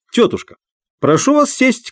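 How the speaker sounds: high command to the dog; calling out. A narrator's reading. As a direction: call out the trick. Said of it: "крикнул хозяин". (высокий громкий окрик)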